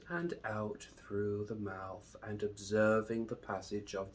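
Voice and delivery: slow, drawn-out meditation voice with long pauses; unhurried, measured calm, lingering on each phrase; guided meditation. Acted. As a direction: guide them calmly into the breath.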